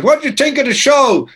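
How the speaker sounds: high, boisterous put-on voice. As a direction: imitate a singer heartily asking for an opinion. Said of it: "And, and he goes".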